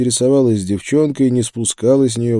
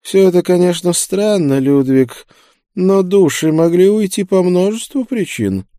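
high-pitched, louder pondering preacher; musing preacher; 9 semitones higher, 5 dB louder than usual. A narrator's reading. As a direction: muse aloud in the preacher's raised voice. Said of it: "стал более серьёзным и, размышляя, протянул". (задумчивый проповедник, высоким голосом)

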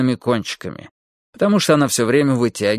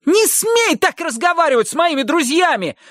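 high, shrill indignant shriek. Acted. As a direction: shriek it in fury at the maid. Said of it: "провизжал Хилли". (пронзительный визг)

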